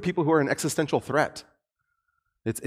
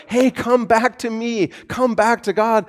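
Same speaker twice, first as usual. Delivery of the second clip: raised, slow imploring call; calling out a plea; 7 semitones higher than usual. Retold or imitated. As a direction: plead like a prophet calling people home. Prophets preaching to the people.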